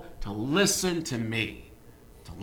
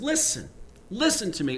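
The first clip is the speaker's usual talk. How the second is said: LISTEN called out high, then pressed again; emphatic; 6 semitones higher than usual. Retold or imitated. Preacher voicing God's plea to his people, emphatic. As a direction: urge them to listen, high and insistent. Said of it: "and it's, it's emphatic".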